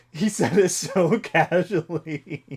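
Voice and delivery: high, loud, drawn out through laughter; laughing. Real, not acted. Recalling the teacher's offhand put-down, laughing. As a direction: crack up while saying it.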